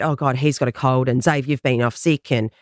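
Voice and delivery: usual speaking voice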